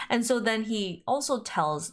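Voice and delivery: usual speaking voice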